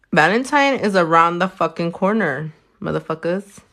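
ordinary delivery

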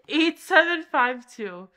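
slow, loud, spelled-out dictation. Real, not acted. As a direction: say each digit slowly and loudly. Dictating code to be written.

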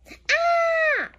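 high squeal of delight; delighted shriek; excited cry. Real, not acted. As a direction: squeal at the picture. Excited at picture.

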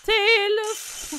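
high, loud, drawn-out Telefon call; high shouted call. Acted. Phone keeps ringing, voice calls out high and loud. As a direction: yell Telefon up high so the house hears.

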